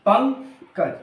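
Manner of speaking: Pankaj split into पंग and कज, with a pause between; syllable by syllable. Shown as a demonstration. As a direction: say Pankaj in two parts, पंग ... कज. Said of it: "वाक्य खंड के अनुसार यह वर्ड". (पंकज को दो खंडों में तोड़कर, पंग ... कज)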